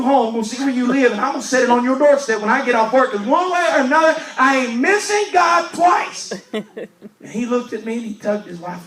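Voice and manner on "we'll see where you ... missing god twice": full-force preaching. Acted. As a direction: belt it out to the congregation. Preaching the quoted vow loudly.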